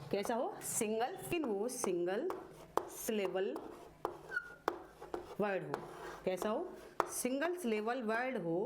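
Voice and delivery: measured phrases with long pauses and repeats. Shown as a demonstration. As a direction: dictate it bit by bit for copying. Dictates a rule while writing.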